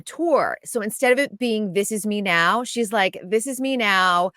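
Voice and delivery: ordinary delivery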